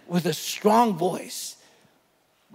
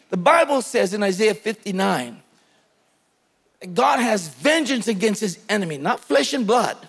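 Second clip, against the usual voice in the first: ordinary delivery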